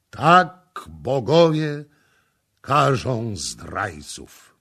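loud, slow, weighty declaration; solemn pronouncement. A narrator's reading. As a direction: pronounce it slowly as a final verdict. High priest's verdict to the prince.

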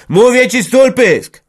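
loud, high furious shout; yelling at his wife. Acted. Husband yelling at his wife to shut up, loud. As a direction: bark the order to shut up. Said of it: "Wrzasnął na żonę".

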